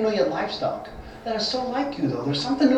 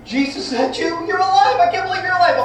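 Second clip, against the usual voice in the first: higher, thrilled cry of recognition; overjoyed disbelief; 9 semitones higher than usual. Acted. Peter seeing Jesus alive, overjoyed. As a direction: Peter shouting with joy.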